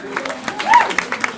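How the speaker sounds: loud, very high "woo!"; whooping cheer. Real, not acted. Host applauding the ushers, whooping cheer. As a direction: let out a high crowd whoop.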